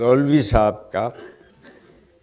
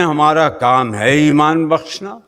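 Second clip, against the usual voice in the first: ordinary delivery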